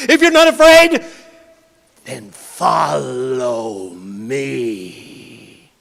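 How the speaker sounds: loud looming creature voice; monster voice. Acted. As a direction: lure the boy downward. Villain invites boy down.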